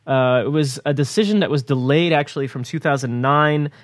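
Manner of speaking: ordinary delivery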